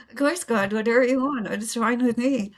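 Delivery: hammed-up fake agreeable smile; fake sweetness. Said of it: "the fakest smile that's ever been".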